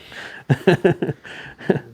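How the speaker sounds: laugh. Real, not acted. Speaker laughs at his list.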